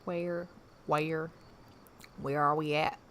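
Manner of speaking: trying out a country accent; light Southern country twang; tentative go at a country accent. Shown as a demonstration. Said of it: "I can try to do a country accent".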